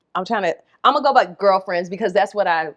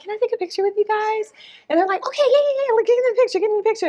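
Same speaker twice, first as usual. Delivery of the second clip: high-pitched eager voice; 12 semitones higher, much faster than usual. Retold or imitated. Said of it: "I was like".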